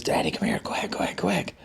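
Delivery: whispered, hurried call for dad; urgent whisper. Retold or imitated. Daughter urgently calls dad over in a whisper. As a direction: hiss it urgently, like a secret emergency. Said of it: "She goes".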